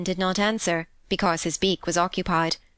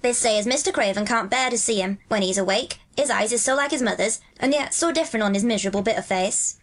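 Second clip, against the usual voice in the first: higher-pitched Yorkshire lad; broad Yorkshire dialect, lilting; Dickon, friendly country lad; 3 semitones higher than usual. Acted. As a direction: chat like a Yorkshire moor boy. Voices Dickon in dialogue.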